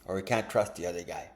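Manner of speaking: ordinary talk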